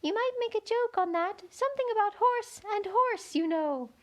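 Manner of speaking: very high, small gnat voice; very small voice at her ear; tiny voice. A narrator's reading. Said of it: "an extremely small voice close to her ear".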